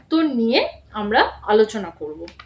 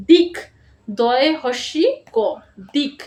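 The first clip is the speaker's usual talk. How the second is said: spelling out; 2 semitones higher, slower than usual. Shown as a demonstration. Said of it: "আমি কিভাবে বলছি".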